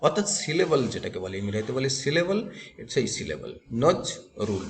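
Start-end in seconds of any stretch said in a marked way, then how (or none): 3.8-4.6 নজ, রুল as two separate beats with a pause between; syllable by syllable. Shown as a demonstration. Teacher showing students the two syllables, নজ and রুল said separately. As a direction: break it into নজ ... রুল. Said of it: "দুটো ঝোঁকে উচ্চারণ করতে পারবো".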